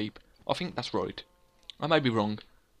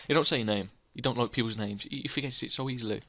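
ordinary talk

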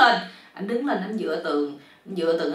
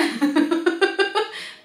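storyteller's laugh; 11 semitones higher than usual. Real, not acted. Laughs at the joke.

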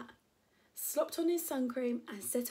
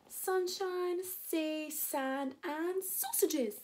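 higher, drawn-out cry of delight; excited cry; 6 semitones higher, much slower than usual. Acted. Mister Wolf looking forward to the seaside, excited cry. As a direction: relish each word, stretching towards the last. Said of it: "cried Mister Wolf".

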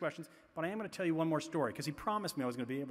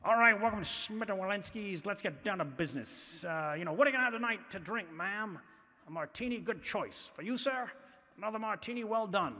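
brisk waiter; 4 semitones higher than usual. Retold or imitated. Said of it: "he says".

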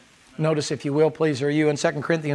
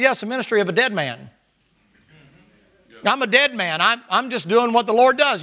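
Paul answering boldly; 6 semitones higher than usual. Retold or imitated. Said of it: "Paul said".

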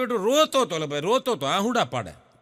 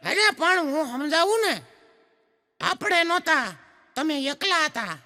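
much higher cheeky schoolboy; child voice; 9 semitones higher than usual. Retold or imitated. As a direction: explain like an impatient kid. Schoolboy explains to teacher. (શિક્ષકને સમજાવતો છોકરો, ઊંચા બાળ અવાજે)

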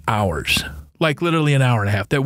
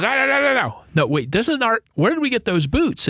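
panicked; 8 semitones higher than usual. Retold or imitated. Panicked crew guy retold.

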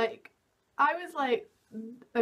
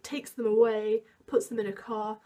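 normal talking voice

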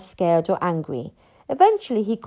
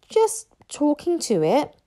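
higher, sheepish admission; embarrassed mumble; 6 semitones higher than usual. A narrator's reading. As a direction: admit it awkwardly, pitched up. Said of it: "mumbled Hiccup, very embarrassed".

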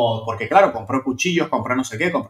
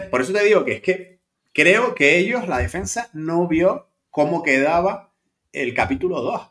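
ordinary talk

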